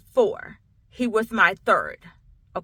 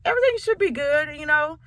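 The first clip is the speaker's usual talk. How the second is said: higher-pitched, lightly voiced doctor; retold pediatrician; 7 semitones higher than usual. Pediatrician tells mother the baby should be fine, lightly imitated. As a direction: put on a high, dismissive-doctor voice, lightly. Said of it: "And she was like".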